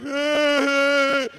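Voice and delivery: high, thin imitated wail; mock crying. The crying girl on the toilet, high mock whimper. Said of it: "she's crying like".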